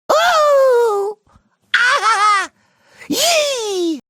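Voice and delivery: short high-pitched cartoon cries; high excited squeals. Hero reacting with excitement, high squealed cries. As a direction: squeal each sound up high.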